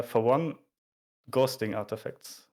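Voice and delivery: ordinary talk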